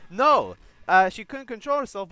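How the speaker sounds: loud, angry rebuttal shout; angry yell. Real, not acted. Fan shouting back at YouTube commenters, angry. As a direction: shout them down. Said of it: "after yelling all that shit".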